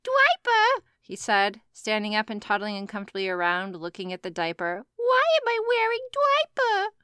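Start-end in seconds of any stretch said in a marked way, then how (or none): 0.0-0.8 put-on high squeaky toddler voice; kid voice. A narrator's reading. Toddler character voice. 4.9-6.9 put-on high squeaky toddler voice; kid voice. A narrator's reading. Toddler character voice.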